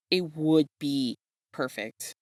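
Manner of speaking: ordinary delivery, nothing marked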